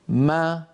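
"ما" with lips parting from m into a long open aa; demonstrating open meem. Shown as a demonstration. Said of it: "فلما تتباعد الشفتان".

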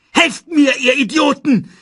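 panicked villain bellowing for backup; shouting for help. A narrator's reading. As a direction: scream it at the useless hyenas. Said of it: "rief Scar den Hyänen zu".

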